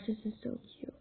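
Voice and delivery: soft unvoiced whisper. Real, not acted. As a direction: whisper it to yourself. Aside while dressing the character.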